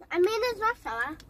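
ordinary delivery

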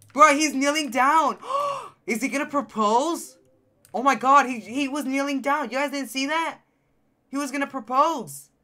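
high, loud astonished outburst; thrilled, high-pitched reaction; excited shock. Real, not acted. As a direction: gasp and exclaim in disbelief. Shocked by character seeming to propose.

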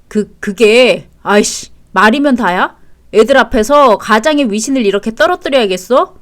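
stammering start, then loud outburst; flustered anger. Acted. Husband losing face before the kids, flustered anger. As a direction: lash out over wounded authority. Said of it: "왜 그렇게 화를 내".

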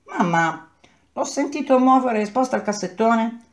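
a lightly voiced girl telling mamma she heard the mouse; little daughter's voice. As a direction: voice the young daughter urging her mother. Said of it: "la mia figliola le ha detto".

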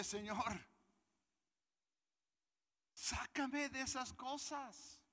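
high, slow whiny plea; pleading with God. Retold or imitated. Preacher retelling his plea in hard times, high whiny mock plea. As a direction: beg to be taken out of it, drawn out and high. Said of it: "Y yo decía".

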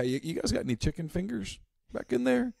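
high, mock-innocent customer voice; mock clueless customer. Customer at barbecue joint asking for hot dog, mock innocent. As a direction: play the naive diner asking for the wrong food.

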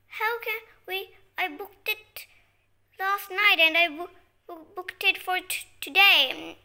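exasperated reply. Pretend. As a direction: insist loudly as if fed up. Acted exasperated reply to sister.

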